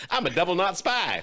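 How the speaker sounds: usual speaking voice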